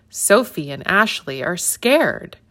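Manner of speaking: usual speaking voice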